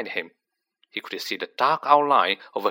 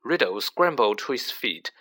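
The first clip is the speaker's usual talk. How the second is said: usual speaking voice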